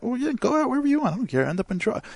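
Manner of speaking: high, fast, flippant brush-off; careless mom. Retold or imitated. Uncaring mom dismissing her grown kid, high and flippant.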